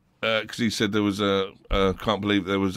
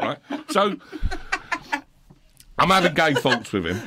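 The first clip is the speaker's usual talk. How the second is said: ordinary delivery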